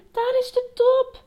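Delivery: high-pitched delighted cry; calling out. A narrator's reading. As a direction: exclaim it as the goal appears. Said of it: "riep hij".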